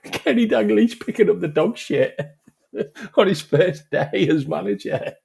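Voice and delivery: high, squealing through laughter; laughing while talking. Real, not acted. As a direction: barely get it out for laughing. Repeats punchline while laughing.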